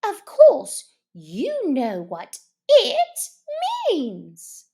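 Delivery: high, sharp, impatient mouse; rather cross. Acted. Mouse crossly answering the Duck, sharp and high. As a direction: snap back impatiently at the Duck. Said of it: "rather crossly".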